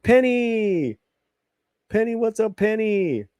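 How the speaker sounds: high, bright calling-out voice to a pet. Real, not acted. Host greeting his dog Penny, high calling voice. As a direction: call the pet's name up high.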